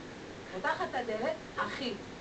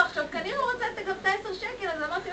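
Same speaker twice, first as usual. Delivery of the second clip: usual speaking voice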